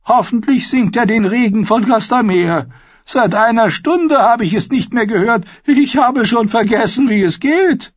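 put-on a tart old matriarch making her request; old lady Olenna. A narrator's reading. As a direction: alte spitze Adelsdame, viel höher. Olenna keeps talking, dry and sharp.

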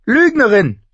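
put-on high, loud girl's outburst; angry accusation. Younger sister accuses older of lying, angrily. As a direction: hurl the insult at your sister, high and loud.

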